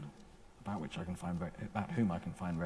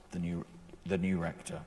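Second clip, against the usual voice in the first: ordinary talk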